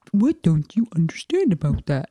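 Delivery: mimicking a mocking, belittling question; put-on condescending jibe. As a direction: quote him as if talking down to someone stupid. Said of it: "And, like, mock me".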